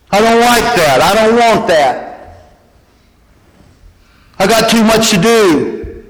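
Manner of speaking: put-on a whining child listing gripes; whiny complaints. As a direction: sound like a sulky kid griping. Acting out typical complaints.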